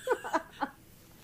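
high, laughing mock accusation; giggling jab at the soldier. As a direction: call him out through a laugh. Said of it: "I was like".

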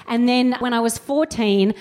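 normal talking voice

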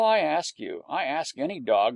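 ordinary delivery, nothing marked

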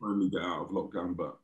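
ordinary talk, nothing marked

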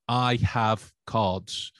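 very slow, word by word; slow and deliberate. Shown as a demonstration. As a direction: spell it out slowly, leaving a gap. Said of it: "did this and went".

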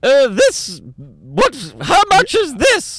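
high, fussy mock British voice; old-school British TV. Imagining the Antiques Roadshow, hammed-up dithering British accent. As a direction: sputter in an exaggerated high-pitched British accent. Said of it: "Is it a British show".

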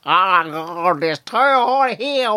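high Australian wail, long drawn out; screamy Australian accent. Acted. Settler suffering the heat, high wailing Australian accent. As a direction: moan in Australian until it becomes a mock scream. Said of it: "it's an inch away from screaming".

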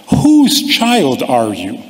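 ordinary delivery

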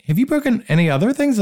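high, suspicious question to dad; suspicious probing. Retold or imitated. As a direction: dig for the truth, pointedly. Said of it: "He's like".

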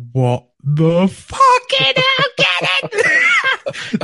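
ecstatic yell. Retold or imitated. On hitting the sixty-five thousand jackpot, ecstatic yell. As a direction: shriek with disbelief and joy. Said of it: "I just went".

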